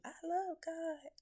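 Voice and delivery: high, slow, put-on churchy voice; mock piety. Mocking hypocrites to viewers, high slow sing-song piety. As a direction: parrot a hypocrite's sweet claim. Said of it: "But you".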